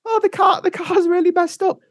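very high put-on woman's voice; man imitating an upset wife; distressed wife. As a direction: wail it in mock-helpless falsetto. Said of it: "I'm her on the phone".

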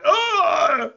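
pained yelp. Retold or imitated. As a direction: cry out as the hat bites into your ears. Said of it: "I was like".

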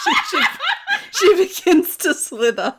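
narration breaking into laughter; laughing. Real, not acted. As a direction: giggle through the words. Narrator cracks up.

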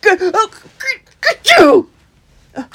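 loud build-up to a sneeze; gasping, explosive mock sneeze; imitated sneeze. A narrator's reading. Christopher with dust in his throat, big acted sneeze. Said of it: "making him sneeze".

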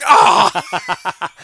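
high loud cartoon scream breaking into a laugh; Mars screaming. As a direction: let out a squealing cartoon scream. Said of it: "shows Mars going".

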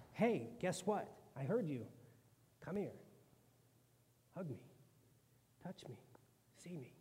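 soft, fading invitation; tender Jesus. Acted. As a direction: draw Thomas in gently. Jesus gently invites Thomas.

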